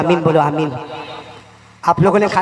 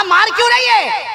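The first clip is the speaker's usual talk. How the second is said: shocked cry; 13 semitones higher, slower than usual. Acted. Husband hit by wife's pot.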